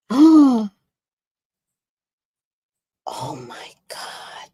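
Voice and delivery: breathy gasp then hushed oh my God; shocked gasp. Retold or imitated. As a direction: gasp it out, then drop to a near whisper. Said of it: "she goes".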